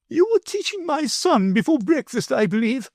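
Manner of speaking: high, haughty grand lady's voice; lofty lady. A narrator's reading. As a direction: talk down to him like a lofty noblewoman. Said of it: "my lady loftily remarked".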